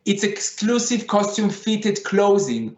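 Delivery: ordinary talk, nothing marked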